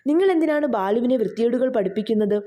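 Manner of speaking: ordinary delivery, nothing marked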